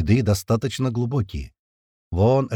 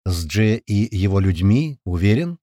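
cautious; slower than usual. A narrator's reading. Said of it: "осторожно спросил я".

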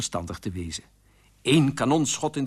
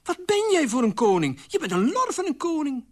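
high, loud telling-off; indignant scolding; 14 semitones higher, 4 dB louder than usual. Acted. Queen berates her useless king, indignant and high. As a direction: scold him hard, pitch raised.

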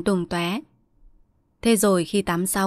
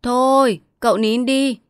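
raised voice over the fence; louder, higher call to hush a child; calling across; 5 semitones higher, 5 dB louder than usual. A narrator's reading. Neighbour hushing the crying boy over the hedge, calling out. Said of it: "đứng ở hàng rào dâm bụt nói sang".

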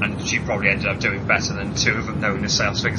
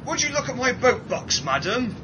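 high mock salesman; 12 semitones higher than usual. Quoting a salesman's line.

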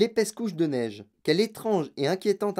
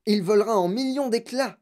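high, panicky warning; raised-pitch protest of alarm; alarmed yell; 6 semitones higher, 3 dB louder than usual. A narrator's reading. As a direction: cry out as if disaster is coming. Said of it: "hurla grand-papa Joe".

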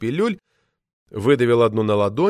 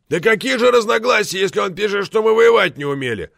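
loud, high outburst of outrage; roaring; 10 semitones higher, 5 dB louder than usual. A narrator's reading. Committee member outraged at slander of the war, roaring. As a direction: roar it indignantly, high and forceful. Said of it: "взревел Борозда".

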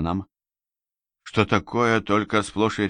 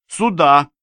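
loud, high command; urgent call; 9 semitones higher, 6 dB louder than usual. A narrator's reading. Rescuer directing the narrator during the escape, urgent call. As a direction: call him over urgently.

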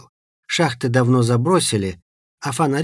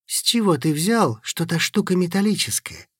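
going on the attack; 6 semitones higher than usual. A narrator's reading. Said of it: "пошёл в атаку Костик".

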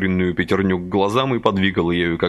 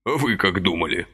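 ordinary delivery

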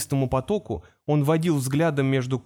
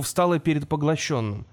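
ordinary delivery, nothing marked

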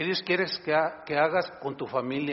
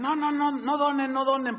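mocking politicians; 8 semitones higher than usual. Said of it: "hay políticos ahorita que dicen".

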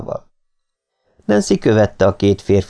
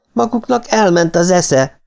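narrated yell; 10 semitones higher than usual. A narrator's reading. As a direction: yell it with pitch more than volume. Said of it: "ordította Martin". (magas hangú, visszafogott ordítás)

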